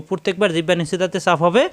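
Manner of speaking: usual speaking voice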